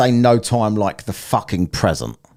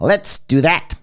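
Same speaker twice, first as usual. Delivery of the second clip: high-pitched mock catchphrase; teasing impersonation; imitated catchphrase; 9 semitones higher, slower than usual. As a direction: parrot someone's catchphrase in a raised pitch. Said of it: "that was his catchphrase".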